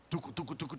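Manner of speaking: very soft playful tucu tucu sounds; soft sucking noise. Retold or imitated. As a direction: mimic sucking pain away quietly. Imitating sucking out the pain. (imitación suave de succionar)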